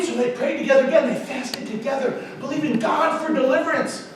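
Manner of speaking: ordinary talk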